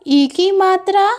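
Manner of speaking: ordinary talk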